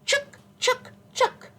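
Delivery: high, sharp squirrel chucks; squirrel chatter. A narrator's reading. Teacher showing children what mama squirrel says, high squirrel chatter. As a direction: squeak it out in quick separate bursts. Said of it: "she'd say".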